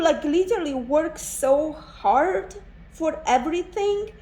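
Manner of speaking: pushing through the urge to cry; real emotion catching the voice; near tears. Real, not acted. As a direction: voice rising and straining as emotion wells up. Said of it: "I don't know why I feel so emotional. I feel like crying".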